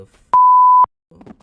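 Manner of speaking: very high slow screech; shocked scream. Real, not acted. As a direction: scream it in disbelief. Shocked at the game.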